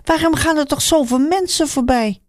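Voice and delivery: high, anxious question; calling out to passersby, confused; puzzled call. A narrator's reading. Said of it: "riep Bartimaeus". (hoog, verbaasd roepen)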